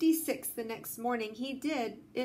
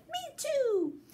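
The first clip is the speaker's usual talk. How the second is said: put-on tiny high baby voice from the pouch; baby kangaroo; 14 semitones higher than usual. A narrator's reading. Young kangaroo in the pouch backs up mother, tiny high voice. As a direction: squeak it like a small joey agreeing with mum. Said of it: "the young kangaroo in her pouch said".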